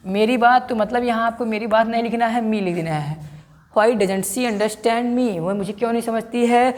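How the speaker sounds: ordinary delivery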